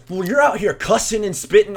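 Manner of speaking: high, loud indignant retort; mock accusing. Husband blaming teen for cussing, mock indignant. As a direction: throw the blame back, imitated.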